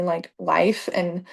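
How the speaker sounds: ordinary talk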